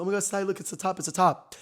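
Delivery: high, excited retold exclamation; excited discovery. Telling Sty the wanted top is found, excited. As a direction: act out an eager find.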